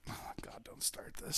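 hushed whisper. Real, not acted. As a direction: drop to a whisper. Whispered aside to co-host.

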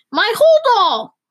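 high, loud, stretched wail of alarm; yelled outcry. A narrator's reading. Passenger fearing for her luggage, yelling. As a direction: yell it out high and slow. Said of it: "yelled a mud woman".

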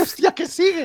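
very high, incredulous squeak; retold surprise. Viewer realises the battle scene goes on, astonished. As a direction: re-enact being amazed, voice shooting up.

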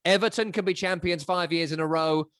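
ordinary delivery

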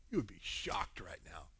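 low, near-whispered aside; hushed emphasis. Retold or imitated. Jesus hinting who he is to woman, hushed. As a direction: drop low and quiet to let it sink in.

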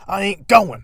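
high, loud mock yell of a thug refusing; mock defiant yell. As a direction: bellow it like a tough guy refusing arrest. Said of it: "he's like".

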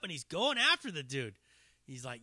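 ordinary talk, nothing marked

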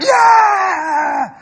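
high, loud exultant yell; joyful shout. Retold or imitated. Pastor showing congregation how to praise, loud high yell. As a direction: shout it out to God. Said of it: "God wants us to be like".

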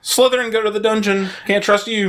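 mock teacher's order. Acting out order to students.

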